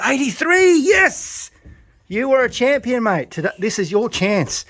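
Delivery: very high, loud yell of victory; triumphant shout. Real, not acted. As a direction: roar the bird's win. Champion pigeon confirmed.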